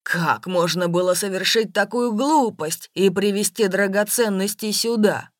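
loud, forceful reproach; angry shouting. A narrator's reading. Businessman berates his beloved for her folly, shouting. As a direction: berate at full voice. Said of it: "«Не ори на меня".

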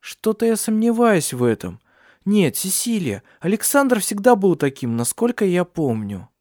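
high, vehement doubt; bitter. A narrator's reading. Ursula condemning Alexander to Cecilia, bitter. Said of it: "с горечью произнесла Урсула".

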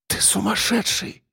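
high, hushed, softened voice; whispering. A narrator's reading. Captive calls captor insane, whispering. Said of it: "прошептала она".

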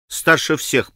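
ordinary delivery

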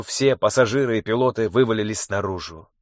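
usual speaking voice

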